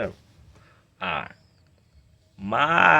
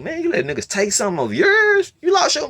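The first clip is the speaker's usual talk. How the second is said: incredulous yell; 4 dB louder than usual. Retold or imitated. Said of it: "I said".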